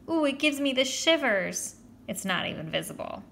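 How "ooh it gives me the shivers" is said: put-on high, squeamish girlish thrill; gushing schoolgirl voice; fawning girl. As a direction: coo with delighted shivers. Judy fawns over Joey.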